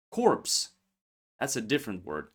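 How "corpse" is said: corpse said higher with a clear ps, korps; contrast corpse. Shown as a demonstration. Teacher contrasts corpse with core for students, high and clear. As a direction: sound the ps in corpse. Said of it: "don't confuse this word with the word".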